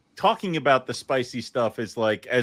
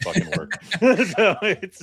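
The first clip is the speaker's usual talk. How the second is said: laughing; 6 semitones higher, slower than usual. Real, not acted. Laughing about cussing.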